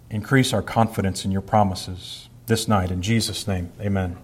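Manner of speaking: soft, hushed prayer voice. Acted. Pastor praying over the congregation at night, hushed and earnest. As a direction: pray quietly for the gathered people.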